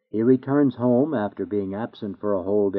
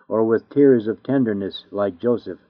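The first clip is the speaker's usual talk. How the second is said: ordinary talk, nothing marked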